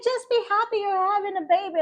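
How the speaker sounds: high-pitched quoted reassurance; high imitation of the other woman; imitating the technician. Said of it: "she said".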